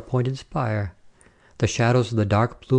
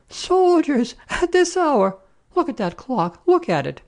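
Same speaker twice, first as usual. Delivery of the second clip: high-pitched outraged screech; scandalised landlady's shrill cry; screaming woman. A narrator's reading. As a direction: shriek the complaint, easing down at the end. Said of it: "screamed a woman".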